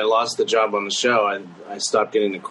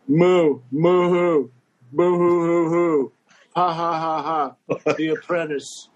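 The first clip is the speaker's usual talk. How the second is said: high mock boo-hoo, slow, into laughter; fake crying; 6 semitones higher than usual. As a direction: Trump tickled by himself, fake sobbing. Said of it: "I would go".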